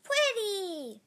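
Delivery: put-on high, loud little-girl squeal; delighted child. A narrator's reading. Little girl admiring monster's claws, delighted squeal. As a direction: burst out in admiration. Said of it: "Emma blinked and said".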